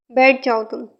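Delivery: ordinary delivery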